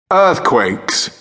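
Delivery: ordinary talk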